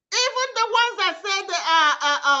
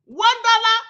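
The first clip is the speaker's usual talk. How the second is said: ordinary talk, nothing marked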